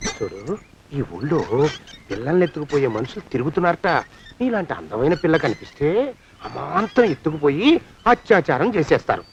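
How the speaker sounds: low, soft cautionary tale; ominous, confiding undertone; hushed warning. Acted. Man warns girl of kidnappers.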